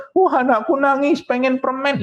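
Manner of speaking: high, fussing doting parent; mock doting parent. Mocking parents who give in to crying, high and fussy. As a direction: whine along with the crying child.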